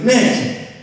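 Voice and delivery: high, whiny protest at the roommate; exasperated complaint. Retold or imitated. Calling to roommate Nick from the shower, exasperated. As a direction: complain he's ruining the shower. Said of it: "I'm like".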